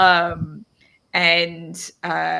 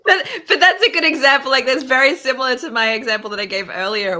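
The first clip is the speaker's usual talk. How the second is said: laughing, louder and fast; 6 semitones higher, 8 dB louder, faster than usual. Real, not acted. Friend laughing as she compares her own example. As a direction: talk quickly through laughter.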